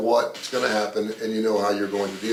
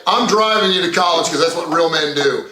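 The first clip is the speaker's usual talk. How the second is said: loud, higher-pitched insistence; mock firm dad; 6 semitones higher, 8 dB louder than usual. Father telling his daughter he drives her to college, mock forceful. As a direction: lay down the law loudly, playing the father.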